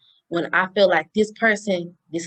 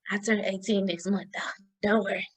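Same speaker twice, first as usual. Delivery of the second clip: breathy aside, barely voiced; whispered afterthought to the audience; 5 dB quieter than usual. Real, not acted. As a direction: tack it on as a secret. Whispered aside about turning eighteen.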